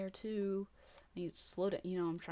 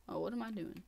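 ordinary talk

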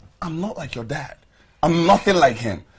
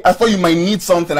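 ordinary delivery

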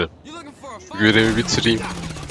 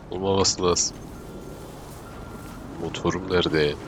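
ordinary talk

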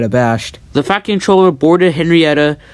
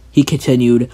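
plain reading voice

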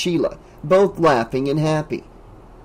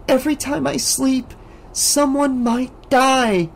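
pleading Alice; 11 semitones higher than usual. A narrator's reading.

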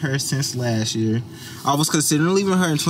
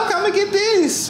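pleased squeal. Retold or imitated. Happy to find conditioner.

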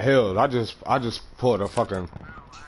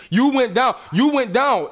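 much higher, insistent blaming; heated argument; 10 semitones higher, 3 dB louder, slower than usual. Real, not acted. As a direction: argue it, pitch climbing. Heated argument after the fight.

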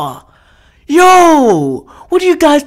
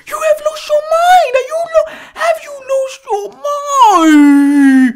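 exasperated wail; 12 semitones higher than usual. Real, not acted. Viewer berating river anglers for lost minds, exasperated wail. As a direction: wail it in real disbelief, voice pushed way up.

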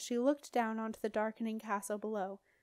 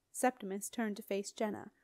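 plain reading voice

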